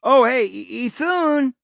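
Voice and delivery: very high, stumbling mock calls; mocking wrong versions of his name. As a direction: trip over the wrong names, high. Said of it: "They never said".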